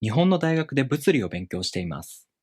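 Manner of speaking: "日本の大学で物理を勉強しています" read briskly, rising early then stepping down; model Japanese intonation. Shown as a demonstration. Teacher models intonation for learners to compare, "日本の大学で物理を勉強しています" briskly stepping down. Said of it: "compare your intonation to my intonation".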